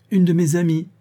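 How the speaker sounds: light, high-pitched reply; a touch of amusement; amused. A narrator's reading. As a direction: answer playfully, pitch lifted. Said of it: "répondit Yvonne en riant".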